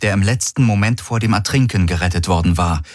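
usual speaking voice

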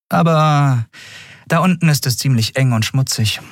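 high captain's voice; woman captain cautioning. Acted. Captain warns the boy.

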